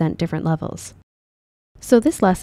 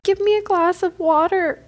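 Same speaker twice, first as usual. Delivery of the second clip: much higher, squeaky cartoon pitch; high pitched take; 12 semitones higher than usual. Emotion game, Inside Out characters.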